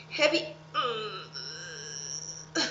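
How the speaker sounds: low, slow mock heave; pretend straining. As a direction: groan as if under a great weight. Demonstrates heavy with effort sound.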